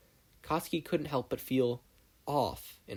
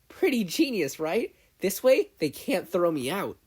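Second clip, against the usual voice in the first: much higher and louder, gleeful; proud excitement; 8 semitones higher, 7 dB louder, slower than usual. Acted. Mei bragging about welding herself to the desk. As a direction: show off a silly idea.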